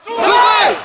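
high, loud group shout; shouted reply. Real, not acted. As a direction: roar the answer back. Ranks answer the greeting.

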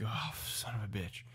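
breathy, whispered dread; hushed cursing. Retold or imitated. Surfer left on the sand cursing his friend, hushed retold dismay. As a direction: curse softly under your breath.

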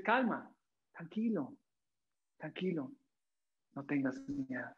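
calm a frightened friend gently; soothing. Acted. Jesus calming the frightened Pedro, soft and soothing. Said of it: "Jesús se conecta emocionalmente".

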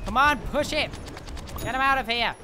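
high, loud urging; real battle cry; fired-up shout. Real, not acted. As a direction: yell at the team to push. Urging team in fight.

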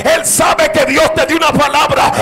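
shouted, insistent revival sermon; full-force preaching. Real, not acted. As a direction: shout it out to rouse an amen.

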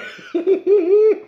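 loud, very high squealing giggle. Real, not acted. Reviewer feeling the burn build, giggling high.

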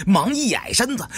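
plain reading voice

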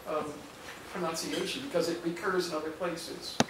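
ordinary talk

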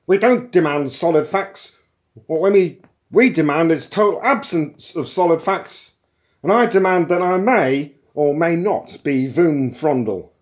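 high, loud muddled tirade; shouting. A narrator's reading. Intruder reversing his demands without pause, shouting. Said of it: "Scarcely pausing for breath, Vroom Fondle shouted".

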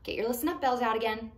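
ordinary delivery

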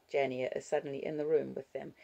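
ordinary delivery, nothing marked

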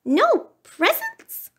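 very high, slow, dismayed squeak; shocked mouse. A narrator's reading. Mouse learns there are no presents, shocked high squeak. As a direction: squeal it in disbelief, drawn out. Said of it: "Mouse's eyes opened wide".

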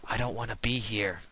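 whispering to yourself, mortified among friends; embarrassed whisper. Retold or imitated. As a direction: hide in your own whisper. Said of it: "you're like".

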